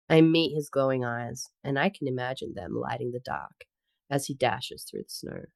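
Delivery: composed romance audiobook reading; story narration. Acted. Reader telling of snow monster carrying heroine, story narration. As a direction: read the tale in measured phrases, settling at the ends. Reads fiction excerpt aloud.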